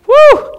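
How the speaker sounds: sudden high whoop; loud high whoop. Real, not acted. Preacher whooping at James's bold line, loud and high.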